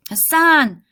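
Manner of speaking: loud, high call of a name. Acted. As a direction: shout it into the crowd. Searching, calling his name.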